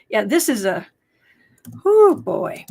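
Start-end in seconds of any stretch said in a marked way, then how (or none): 1.8-2.6 high, loud groan of dread. Real, not acted. Host reacts to a dreaded chat question, exasperated and loud.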